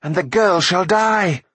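high, slow, fierce sorceress; flung-back threat. A narrator's reading. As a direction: threaten with drawn-out, rising intensity. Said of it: "Akron flung back".

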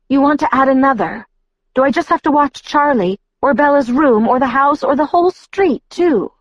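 high, exasperated tirade, building; cold retort. A narrator's reading. Alice answers Edward's doubts, cold and exasperated. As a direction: pile up the demands in rising irritation. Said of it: "Alice's voice was cold when she answered".

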